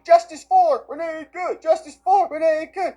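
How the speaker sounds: high, loud imitation of a protest chant; mock protest yelling. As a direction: yell it out like a mocked crowd of agitators. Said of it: "can be heard yelling".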